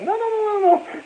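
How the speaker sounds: high mock wife saying no; mock wife protest. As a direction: play the wife pushing back. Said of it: "she's like".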